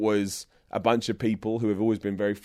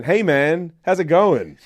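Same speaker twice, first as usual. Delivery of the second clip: British speaker trying out casual American; attempted American accent; 4 semitones higher, 7 dB louder, slower than usual. Shown as a demonstration. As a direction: have a go at an American accent, slightly louder and higher. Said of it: "can you do a pretty good American accent".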